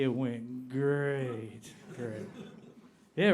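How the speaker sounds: ordinary delivery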